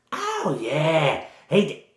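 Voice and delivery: high-pitched Cockney village woman; bad Cockney accent. Village woman answering the adventurers about a missing farmer, in bad Cockney. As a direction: put on a hammy Cockney voice. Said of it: "she has a sort of bad Cockney accent".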